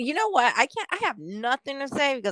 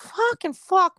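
mock frustrated curse; 9 semitones higher, 5 dB louder, slower than usual. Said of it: "I'm just like".

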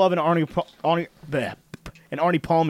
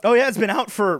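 voice cracking; 6 semitones higher, 3 dB louder than usual. Real, not acted. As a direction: voice breaks upward mid-sentence. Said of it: "Excuse my voice crack there".

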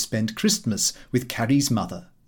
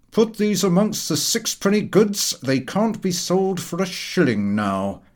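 a touch of an irritated shop manager, higher; mild annoyance; 6 semitones higher than usual. A narrator's reading. Manager sniping at clumsy customer, irritated. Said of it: "looking very much annoyed".

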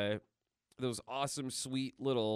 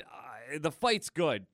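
ordinary talk, nothing marked

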